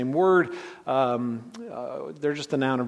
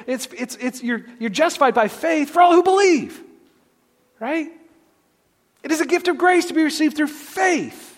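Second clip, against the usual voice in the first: much higher and louder, full preaching cry; impassioned preaching peak; 13 semitones higher, 6 dB louder than usual. Acted. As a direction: proclaim it at the top of your voice.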